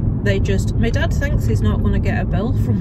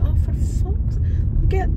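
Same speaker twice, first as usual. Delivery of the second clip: high-pitched fed-up burst, real exasperation; exasperated outburst; 11 semitones higher, slower than usual. Real, not acted. Vlogger venting at viewers about a parent, exasperated. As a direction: throw your hands up in disbelief.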